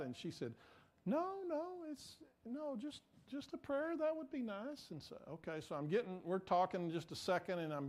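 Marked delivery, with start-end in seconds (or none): 1.1-4.9 much higher, modest, gentle reply; imitated sweet woman. Lady answering a stranger who offers prayer, sweet. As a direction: imitate a lady politely asking for little. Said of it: "she was so sweet".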